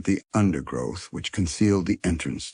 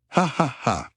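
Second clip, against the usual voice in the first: chuckle; 9 semitones higher than usual. A narrator's reading. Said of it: "chuckled Missus Brown".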